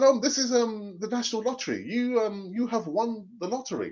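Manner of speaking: a prank caller posing as the lottery; fake official. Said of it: "I said".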